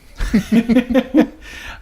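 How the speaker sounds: high, loud burst of real laughter; laughing at the gesundheit. Real, not acted. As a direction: break into an unplanned real laugh. Real laugh after the sneeze.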